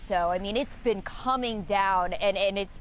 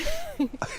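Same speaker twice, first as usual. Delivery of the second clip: kind gentle laugh. Real, not acted. Laughs at her pathetic snowman.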